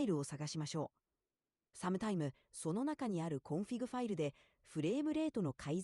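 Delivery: rapid Japanese speech; fast Japanese. Shown as a demonstration. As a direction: rush through the Japanese quickly. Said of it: "talk like you talked before with the Japanese stuff".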